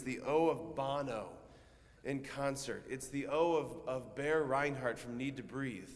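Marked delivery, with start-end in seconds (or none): none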